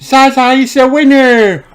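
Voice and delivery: high, loud proud announcement; announcing the winner. A narrator's reading. Emily Elephant announcing the musical statues winner. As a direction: proclaim it to the whole party. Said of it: "Emily Elephant announced".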